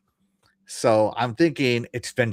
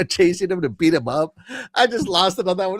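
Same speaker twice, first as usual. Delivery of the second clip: ordinary delivery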